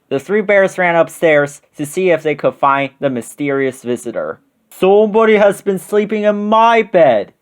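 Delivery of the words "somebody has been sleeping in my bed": high-pitched, booming big bear; great big voice. A narrator's reading. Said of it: "in his great big voice".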